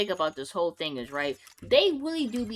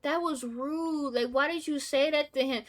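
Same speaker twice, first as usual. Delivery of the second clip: slow, higher-pitched prissy mock voice; mocking fake concern; 5 semitones higher than usual. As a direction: parody their clutching-pearls tone. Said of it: "they be trying to act like".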